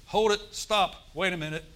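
a pastor stopping a worldly chatterer, loud and urgent; urgent loud interruption. Pretend. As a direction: stop them short and call them out loud. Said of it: "You feel like saying".

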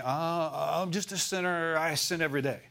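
hammed-up self-deprecation, stretching the words out; mock false humility. Preacher imitating believers who call themselves sinners, falsely humble. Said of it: "sounds like humility 'cause they're saying".

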